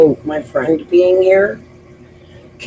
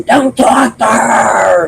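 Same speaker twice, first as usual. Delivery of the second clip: higher, louder, very slow shouted order; retold background scream; 8 semitones higher, 6 dB louder, much slower than usual. Candace shouting at Dawn from the background, higher and slow. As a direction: yell it from across the room. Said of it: "Candace would be in the background screaming".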